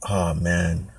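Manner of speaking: ordinary talk, nothing marked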